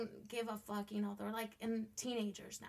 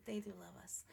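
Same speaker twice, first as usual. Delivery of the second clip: soft whisper; 4 semitones lower, 10 dB quieter, faster than usual. Real, not acted. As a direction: whisper it tenderly. Tender aside about kids.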